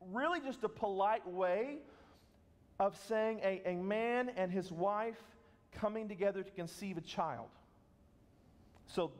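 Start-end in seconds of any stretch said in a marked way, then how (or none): none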